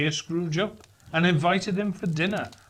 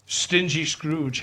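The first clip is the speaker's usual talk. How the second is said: ordinary delivery, nothing marked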